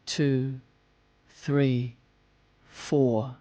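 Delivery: softening, trailing count for the exhale. Shown as a demonstration. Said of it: "and breathe out".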